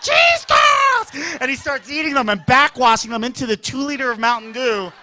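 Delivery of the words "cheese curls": high, loud mock hyper kid; over-excited shriek. Brother hopped up on caffeine, excited shrieking. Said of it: "he's so excited".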